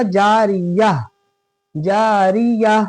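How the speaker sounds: slow जारिया ending in h, no -tun; correct pausal ending. Shown as a demonstration. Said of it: "क्या होगा?". (जारिया, गोल ता हा बन के)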